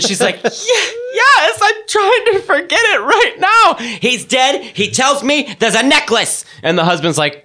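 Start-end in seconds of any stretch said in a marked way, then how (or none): 0.4-6.5 very high, slow hammed-up wail; high laughing mock grief. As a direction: whine it in falsetto through laughter. Grieving woman mimicked.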